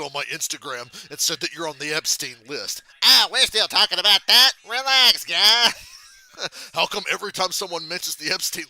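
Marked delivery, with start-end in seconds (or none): 3.0-5.7 loud, high brush-off; mock defensive dismissal. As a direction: wave the accusation away, loudly and high.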